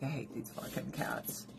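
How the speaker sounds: hushed, unvoiced imitation of her; breathy whispered mock complaint; whispered quote. Friend whispering her hatred of the cats. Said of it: "She's like".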